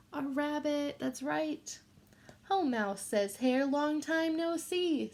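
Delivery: put-on reading a picture book to children; gentle read-aloud for a class; story reading. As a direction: read it like storytime for little kids.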